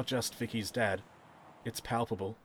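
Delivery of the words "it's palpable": ordinary delivery, nothing marked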